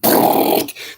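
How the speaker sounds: loud rattling mouth-made machine-gun burst; imitated gunfire. Gunner firing from the helicopter, loud imitated gunfire.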